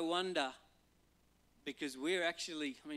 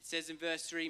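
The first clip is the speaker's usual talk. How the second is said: usual speaking voice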